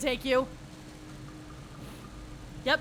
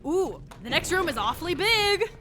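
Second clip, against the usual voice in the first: mock wonder; 5 semitones higher, 5 dB louder than usual. Mock naive before boss room.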